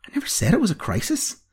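high-pitched petulant protest; petulant, pitched well up. A narrator's reading. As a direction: protest like a peeved apprentice. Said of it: "asserted Roisin petulantly".

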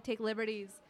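ordinary talk, nothing marked

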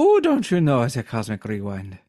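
silly Irish caricature; Irish accent. Acted. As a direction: put on a cartoon Irish lilt. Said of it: "only do an Irish accent".

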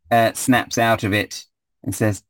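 normal talking voice

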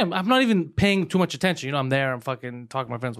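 ordinary talk

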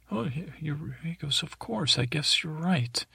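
high, soft creature reply; meek mock-weepy voice. Weepy creature conceding to a stranger, high and meek. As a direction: lightly play a tearful concession.

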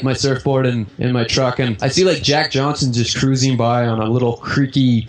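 ordinary delivery

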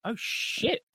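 high-pitched dawning surprise; sudden realisation. Retold or imitated. Viewer spotting who is under the makeup, mock-amazed. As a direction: let recognition jump up in pitch.

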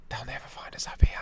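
sneaky whisper. Pretend. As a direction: hush it like hiding socks. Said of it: "they're all like".

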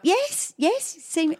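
high, quick-to-answer yeses; eager response. Retold or imitated. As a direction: answer eagerly when your name is called. Jumps up answering the call.

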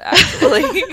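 breaking into a high laugh. Real, not acted. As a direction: laugh through the word. Laughing at tavern comparison.

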